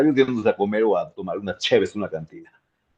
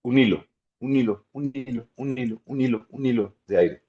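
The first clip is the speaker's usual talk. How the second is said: thread of air; 3 semitones higher than usual. Shown as a demonstration. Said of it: "como si fueras a apagar una velita".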